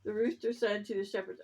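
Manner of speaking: plain reading voice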